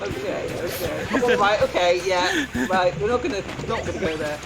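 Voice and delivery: ordinary talk, nothing marked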